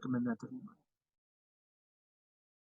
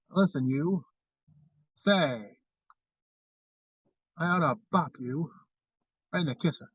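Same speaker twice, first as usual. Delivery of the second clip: Mid-Atlantic accent; 5 dB louder than usual. Shown as a demonstration. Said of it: "I bust into one every once in a while".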